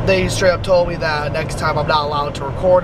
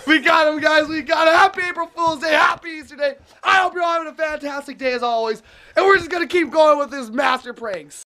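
high, buzzing after-prank hype; excited; 9 semitones higher than usual. Real, not acted. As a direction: celebrate the win to the camera. Celebrating the prank to viewers.

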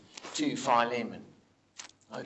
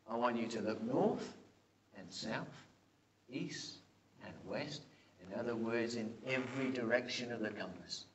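tender voice of God; 5 semitones lower, 6 dB quieter than usual. Acted. Preacher voicing God's promise.

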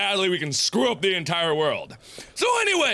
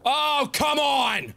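high, exasperated yell rising to a scream; frustrated outburst building to a shriek; real frustration; 7 semitones higher, much slower than usual. Real, not acted. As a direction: explode at the game. Hits dead ends in the game.

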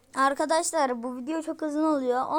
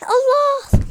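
shriek; 11 semitones higher, 12 dB louder than usual. Real, not acted. Gamer fails jump, frustrated.

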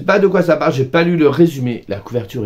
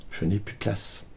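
resigned quiet admission; 3 semitones lower, 11 dB quieter than usual. Real, not acted. Answers her own scolding.

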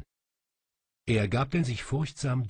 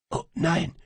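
ordinary delivery, nothing marked

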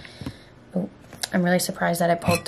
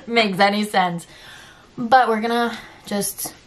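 ordinary talk, nothing marked

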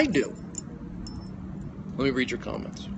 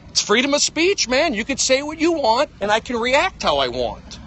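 loud, high, angry insistence; heated rant; 10 semitones higher, 4 dB louder than usual. Real, not acted. Ranting at a comedian on stream, loud and high. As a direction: yell your right to speak.

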